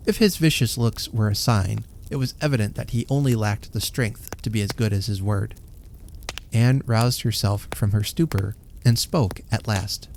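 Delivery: usual speaking voice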